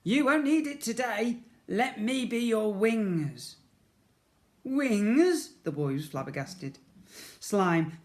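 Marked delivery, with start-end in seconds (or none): 0.1-3.6 high, slow gentle promise; reassuring Slime. A narrator's reading. Slime reassuring Ned he won't need his wheelchair. 4.6-5.5 high, loud amazed question; flabbergasted. A narrator's reading. Ned amazed at Slime's offer, high and loud.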